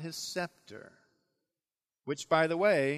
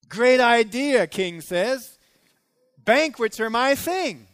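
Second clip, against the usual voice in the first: loud, high, eager yes from the king; the king jumping at a party; a king who loves banquets accepting loudly; delighted king; 6 semitones higher, 10 dB louder, slower than usual. Acted.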